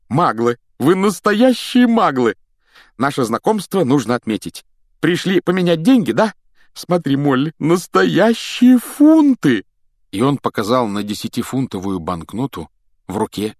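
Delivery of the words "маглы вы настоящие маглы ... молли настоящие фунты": high, loud, stretched-out wonder; thrilled by real Muggle money; delighted. Acted. Mr Weasley marvels at the Grangers, loud delight. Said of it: "восторженно".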